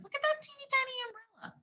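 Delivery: high, soft and fast, cooing over something tiny; quick, hushed, high delight at a tiny drawing; cutesy aside. Acted. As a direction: coo at the little umbrella like it's adorable. Teacher points at tiny picture.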